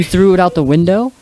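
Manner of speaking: loud, high retold question with rising end; incredulous. Retelling Shaggy questioning Scooby about the key, mock incredulous. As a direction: throw it back in mock disbelief. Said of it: "And he's like".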